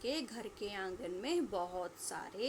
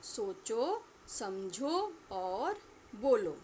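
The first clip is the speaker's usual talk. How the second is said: slow teaching demo; much slower than usual. Shown as a demonstration. Teacher spells out the task.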